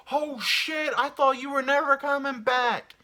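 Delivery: high, loud, thrilled greeting; dog welcoming its owner at the door; overjoyed dog. Pretend. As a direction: burst out like a dog whose owner is home.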